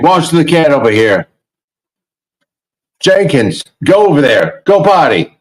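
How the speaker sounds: sing-song command to a pet; coaching a cat. Retold or imitated. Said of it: "And then he's like".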